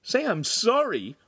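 high, slow disbelieving question; incredulous reply. A narrator's reading. Choked apprentice answers hidden voice, incredulous. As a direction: echo it back in disbelief. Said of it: "Lincoln replied out loud".

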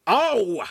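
loud, high yelp of pain; sudden loud "ow" of pain; pained scream. A narrator's reading. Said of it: "the enactor screamed".

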